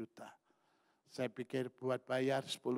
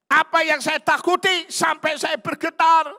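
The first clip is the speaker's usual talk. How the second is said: very high, screamed rhetorical question; full-force shout; 18 dB louder than usual. Real, not acted. Preacher demanding what he feared so much, screamed at congregation. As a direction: scream the question at the peak of the sermon.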